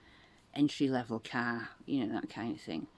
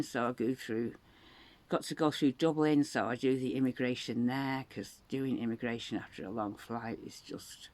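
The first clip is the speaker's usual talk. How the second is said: ordinary delivery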